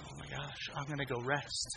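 very soft, low murmur; hushed relief. Retold or imitated. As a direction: say it low so the baby stays asleep. Creeping from sleeping baby's nursery.

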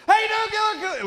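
high manic shouted greeting; street scream. Retold or imitated. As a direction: scream it at passers-by. Guy runs into street screaming.

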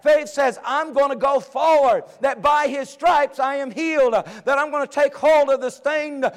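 high, loud, driving sermon delivery; rousing pulpit exhortation; full-force preaching. Real, not acted. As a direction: preach it hard, building to a peak.

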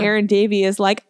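usual speaking voice

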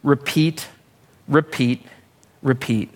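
"repeat" three times, dying away; fading repeats. Shown as a demonstration. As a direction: let each "repeat" sink and fade. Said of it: "notice at the bottom".

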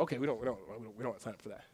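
soft, low, hurried retreat; mock-timid stammered refusal, tailing down; quickly and quietly back out of the deal; meek backing off. Acted congregation backing away from judgment.

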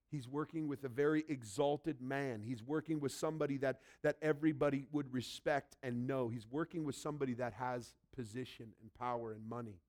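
ordinary talk, nothing marked

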